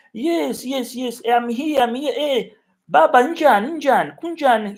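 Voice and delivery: high, loud, eager hello to a friend; excited greeting. Real, not acted. Streamer greets a loyal viewer Baba, high and loud with delight. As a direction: call out joyfully to a familiar face.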